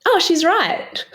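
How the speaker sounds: high, breezy imitation of the doctor; doctor's offhand reply. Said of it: "And then he went".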